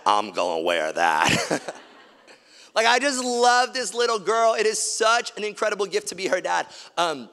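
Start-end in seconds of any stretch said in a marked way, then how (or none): 0.0-1.6 a playful mock-deep brag, drawn out. Dad teasing his daughter about matching outfits, mock deep voice. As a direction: drop way lower and slow it down.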